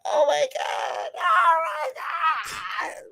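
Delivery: hammed-up wailing. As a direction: wail it out like she's falling apart. Girl crying over her dead friends.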